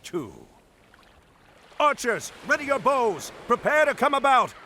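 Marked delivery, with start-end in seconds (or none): 1.8-4.5 higher commands called to the crew; rally the archers for battle. Acted. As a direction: admiral calling orders across the deck. Admiral commands troops in battle.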